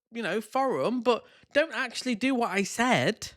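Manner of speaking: ordinary talk, nothing marked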